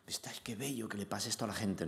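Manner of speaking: hushed, tender near-whisper, as if choked up; softly awed, voice dropping almost to breath; moved near-whisper. Retold or imitated. Actor recalling the tearful audience, moved near-whisper. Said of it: "lágrimas en los ojos".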